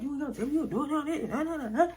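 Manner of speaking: high, quick, laughing mock of the jealous boyfriend. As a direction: poke fun at his puffed-up anger. Mocking the angry boyfriend.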